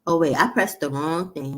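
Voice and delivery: ordinary talk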